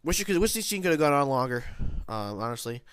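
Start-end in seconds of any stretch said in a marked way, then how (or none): none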